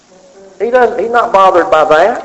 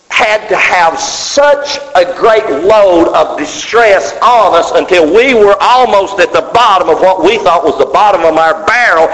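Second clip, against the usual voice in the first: usual speaking voice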